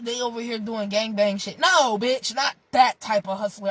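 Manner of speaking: normal talking voice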